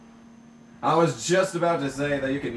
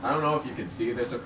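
ordinary delivery

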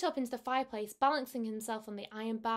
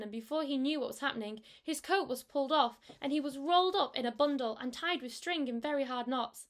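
usual speaking voice

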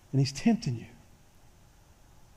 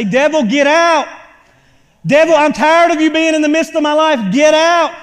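loud, high rebuke of the devil; commanding shout; 6 semitones higher, 7 dB louder than usual. Shown as a demonstration. Preacher demonstrating how to rebuke the devil, shouting. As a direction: stand your ground and cast him out. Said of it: "stand your ground and say".

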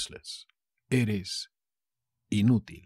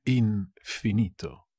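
"Infinito" drawn out with a split after "In"; slow, broken up. Shown as a demonstration. Teacher repeating a word for learners, slow and broken up. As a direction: say "Infinito" slowly, in parts.

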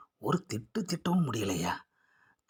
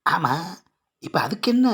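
sharp, loud and high impatient question; raised voice demanding what that matters; impatient retort; 6 semitones higher, 7 dB louder than usual. Acted. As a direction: snap back at her, exasperated.

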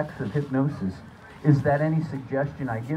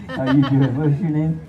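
ordinary talk, nothing marked

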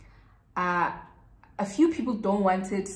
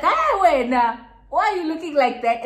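teasing; 7 semitones higher, 7 dB louder than usual. Retold or imitated. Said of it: "Bully kept being like".

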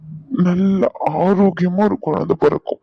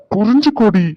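raised, higher-pitched urging; exasperated plea; 5 semitones higher, 6 dB louder than usual. Acted. Husband urges crying wife to understand, exasperated plea. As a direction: burst out, begging to be understood.

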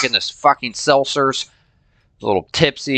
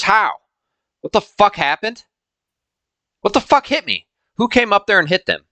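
outraged disbelief; 10 semitones higher than usual. Real, not acted. Lost 2100 warriors in battle.

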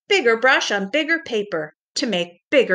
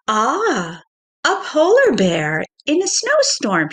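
playful teacher voice rising high and louder; teasing art teacher; 6 semitones higher, 4 dB louder, slower than usual. Acted. Art teacher jokes about the empty paper, playful and bright. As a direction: tease her gently about the blank page.

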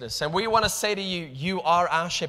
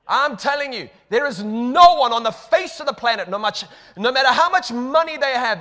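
louder and higher, driving the point home; rising preaching shout; 7 semitones higher, 7 dB louder than usual. Real, not acted. Preacher pleads with congregation, loud and higher. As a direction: preach it out full force.

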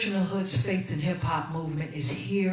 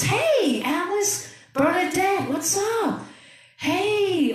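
much higher, bright hello; excited greeting; 10 semitones higher, slower than usual. Real, not acted. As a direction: call out to each new viewer. Greeting viewers joining the live.

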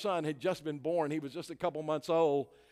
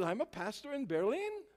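put-on German accent; 8 semitones higher than usual. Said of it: "I heard this German boy say".

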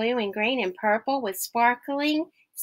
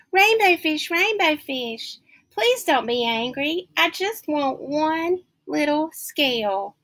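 small fish begging, voice raised high; high pleading little fish; 6 semitones higher than usual. Acted.

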